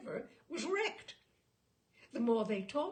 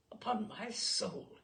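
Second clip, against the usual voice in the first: hushed exclamation; slower than usual. A narrator's reading.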